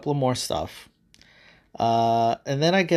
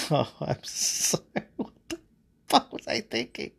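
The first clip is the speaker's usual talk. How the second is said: words squeezed out through laughter; broken chuckles; 3 semitones higher, 5 dB quieter than usual. Acted. God still cracking up at the platypus, chuckling through words. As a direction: laugh helplessly, gasping out the words. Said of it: "through broken chuckles".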